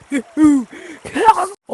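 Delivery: loud villain cackle; evil cackle. Acted. Villain skit begins.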